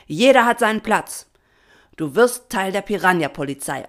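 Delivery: loud, worked-up telling-off; angry scolding. A narrator's reading. Papa berates his daughter once alone, loud and scolding. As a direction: lay into her as a cross father. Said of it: "schimpfte Papa Patron los".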